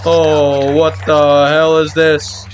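normal talking voice

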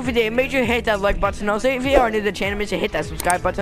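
loud, fast, hyped outro read; youtuber outro. Acted. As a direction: rattle off the outro like an announcer.

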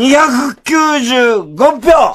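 announcer. Acted. Announces votes.

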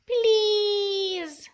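high pleading squeak; tiny mouse voice. Acted. Little mouse begs again.

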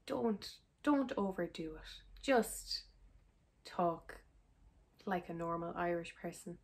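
soft, half-whispered exasperation; exasperated Irish viewer. Retold or imitated. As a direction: sigh it out like a fed-up Irish viewer. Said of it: "any Irish person looking at this is just gonna be like".

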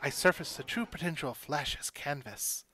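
ordinary delivery

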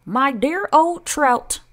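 high, loud, drawn-out scorn; Aunt Sponge crying out. A narrator's reading. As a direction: cry it out slowly in a swooping high aunt voice. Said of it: "Aunt Sponge cried out".

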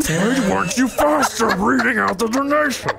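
high mock berating voice. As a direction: scold them in a high shrill pitch. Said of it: "That's me, like, berating you".

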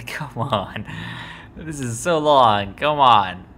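loud, fed-up groan of impatience; frustrated. Real, not acted. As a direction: yell at the slow screen. Impatient at loading screen.

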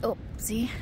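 ordinary talk, nothing marked

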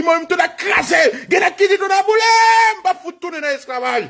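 very high acted yelling with stretched words; enraged rebel cries. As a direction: wail out the call to burn it all.

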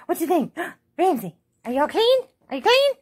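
high, loud pet talk; excited sing-song questions to the clean dog. Real, not acted. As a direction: coo at the dog.

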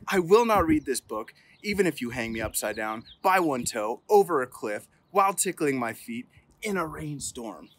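ordinary delivery, nothing marked

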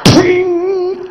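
high ringing ting; clang imitation. Narrator imitating ice clanging on the train cars, high ringing ting. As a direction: ring it like metal on ice.

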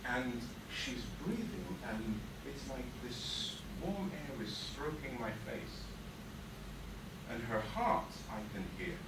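soft, slow, low storytelling; soothed hush. Acted. Boy held to a woman's chest, soothed hush. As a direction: let it slow down like a calm heartbeat.

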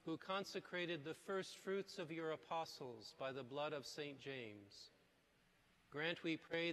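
solemn, measured prayer voice; liturgical prayer. Acted. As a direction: pray it aloud to the congregation. Priest prays the collect.

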